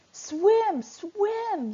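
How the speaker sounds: frantic screamed order while fleeing. Acted. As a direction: scream at them to swim for it. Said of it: "screamed Fitzwater".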